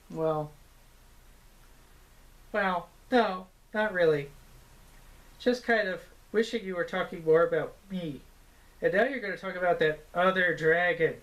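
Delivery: lightly voiced jealous puppet; a hint of a pouty sidekick, whining; sulky puppet voice. Puppet character chiming in.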